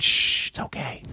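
whispered soothing; mock hush. Calming Larry during the chokehold, whispered mock soothing. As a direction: hush him like a sleepy child.

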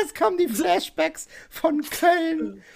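high, slow, laughing through it; lachend. Real, not acted. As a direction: laugh out the flashback line. Laughing about the Cologne memory. (laut lachend)

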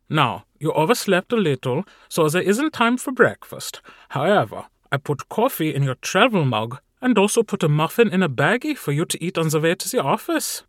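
high, brisk, fussing helper; chirpy servant fussing over his boss; fussy assistant Carl. Acted. Carl's voice, held figure.

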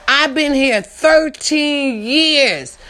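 crying coworker. Retold or imitated. Voicing crying coworker leaving.

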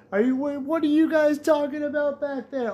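high, puzzled mom voice; mother asking. Retold or imitated. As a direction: voice mom leaning back to ask. Mother asks from front seat.

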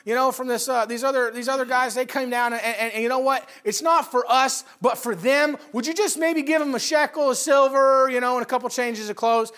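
loud, high, eager made-up story; fake friendly pitch. Gehazi lying to Naaman to get silver, eager loud pitch. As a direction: sell the lie with bright eagerness. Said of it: "He makes up a story and he says".

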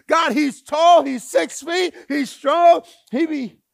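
high, loud, eager pointing out; loud eager prophet showing off his pick; excited Samuel. Retold or imitated. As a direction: hype up the tall candidate to God. Samuel praising candidate.

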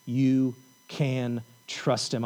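slow, deliberate assurance; slow solemn reassurance; slow and weighty. Acted. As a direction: let each word land slowly. Slow emphatic refrain of sermon.

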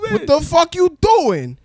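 high, loud furious call-out; a drunk man's outraged shout; angry yell. Retold or imitated. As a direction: bark it at her across the room. Confronting the girl, drunk.

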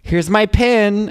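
high, loud and slow show-off announcement; proud status. Retold or imitated. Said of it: "make my status".

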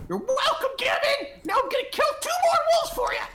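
high, fast, laughing shout; triumphant shout. Acted. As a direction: crow it out in victory. Character shouts triumphantly mid-fight.

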